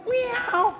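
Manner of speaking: high, squeaky put-on meow; escaping prisoner faking a cat's meow, high and loud. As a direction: pretend to be a cat to fool the guards. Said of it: "It's a good cat".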